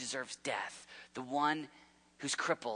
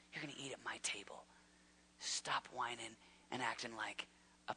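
softer, intimate whisper; tender whisper; 3 semitones lower, 13 dB quieter than usual. Acted. King telling his adopted son he belongs, in a tender whisper. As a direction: hush it like a loving father. God gently rebukes and embraces him.

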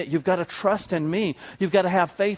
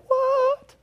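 much higher mock shocked yelp; shocked disciples. Preacher voicing startled disciples, hammed-up shock. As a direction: squeak it in mock alarm. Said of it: "the disciples are freaking out".

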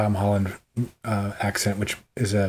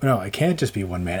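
ordinary delivery

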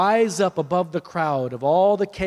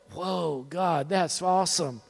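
awed reaction; 5 dB quieter, slower than usual. Acted. Listener looking back at what God did, acted awe. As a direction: draw it out softly in mock-stunned wonder.

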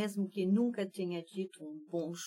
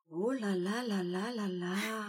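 "Oh lá lá, lá lá, lá lá" stretched slowly, trailing off softer; drawn-out astonishment; 3 dB quieter than usual. Shown as a demonstration. Host demonstrates intensified astonishment, slowly stretching "lá lá". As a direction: let the astonishment spill out slowly. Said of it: "quando a surpresa é tanta que vira espanto".

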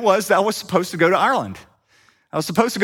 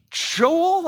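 a much higher, drawn-out surprised "Joel?"; shocked recognition; 12 semitones higher than usual. Retold or imitated. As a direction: imitate a friend who can't believe who's at the door. Stephen shocked to see him.

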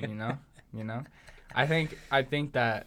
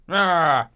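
loud drawn-out grunt; imitating a loud inarticulate moan; James Harden; 3 semitones higher, 9 dB louder than usual. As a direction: blurt a long wordless noise as an impression. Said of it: "I think James Harden's just like".

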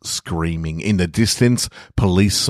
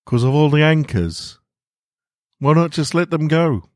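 a lighter, higher voice for Walter; Walter's voice; 9 semitones higher than usual. Acted. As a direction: lift the pitch for the young hero. Walter speaking to the Colossus.

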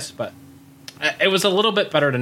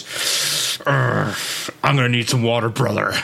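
Hulk Hogan; 2 semitones lower, slower than usual. Retold or imitated. Cornered wrestler asks for water, groaning impression. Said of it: "And he goes".

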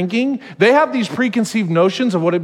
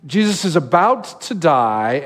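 ordinary delivery

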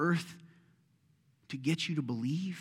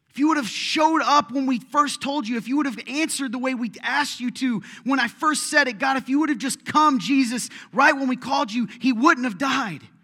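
much higher and louder, hurling each if you would have; anguished shouting complaint; 12 semitones higher, 12 dB louder than usual. Acted. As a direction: yell your grief at God, slowing on the last words. Mary's anguished complaint to Jesus.

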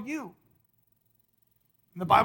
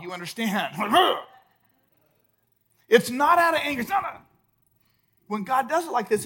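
ordinary talk, nothing marked

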